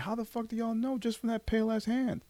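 high-pitched incredulous question; squeaky-high exaggerated disbelief; mock disbelief. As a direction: retell being baffled, voice way up high. Retold disbelief at online fans' guesses.

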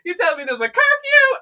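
high, loud disbelief; mock outrage. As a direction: act scandalised at a curfew.

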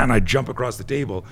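ordinary talk, nothing marked

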